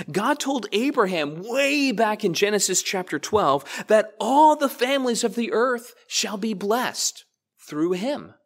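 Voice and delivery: ordinary talk, nothing marked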